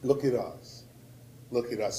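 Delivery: a soft, heavy echo to the crowd; hushed repeat. Real, not acted. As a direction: let it sink in quietly. Repeated plea, dropped low.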